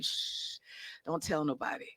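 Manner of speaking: whispered shh, then a hushed aside; a mock-conspiratorial hush before sharing the mantra; playful secret.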